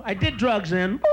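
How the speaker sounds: usual speaking voice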